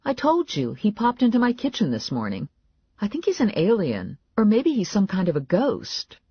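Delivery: high, urgent insistence; insistent heroine. A narrator's reading. Narrator voices heroine insisting to Morelli.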